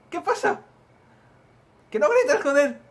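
high, very loud snapping back; exasperated shout. Acted. Telling the other not to shout, exasperated yell. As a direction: yell over the other person. Acted quarrel about shouting.

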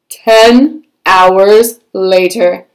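slow announcer read; dramatic caption. Acted. Storyteller announces the time jump slowly and grandly.

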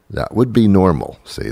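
ordinary delivery

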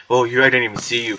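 ordinary delivery

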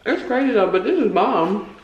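chewing between words; eating with chopsticks; mouth full while talking. Real, not acted. Seen 0: Chopsticks at lips, chewing between bites throughout the words.

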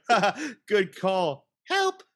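high, slow, lightly voiced Harley quip; chirpy mock cry for help, squeaking up on the last word; Harley quote. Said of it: "And she's like".

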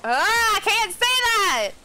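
loud, high cry of frustration; real frustration, high-pitched and stretched out; frustrated outburst. Real, not acted. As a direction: give up in loud exasperation. Frustrated at failed accent attempt.

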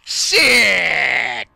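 loud, long drawn-out curse; frustrated shout. Real, not acted. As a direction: yell it out, letting it fall. Reacts to game loss.

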